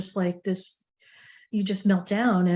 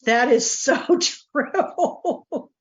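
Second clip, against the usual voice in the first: drawn-out, higher words breaking into laughter; busting out laughing; 6 semitones higher, much slower than usual. Retold or imitated. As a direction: laugh helplessly through the words, stretching them out. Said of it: "I just started busting out laughing".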